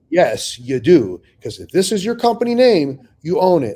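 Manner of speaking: high, loud, slowed mock lecture; exasperated telling-off, played up; emphatic scolding. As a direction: spell it out like they're dense. Telling the fund they own it.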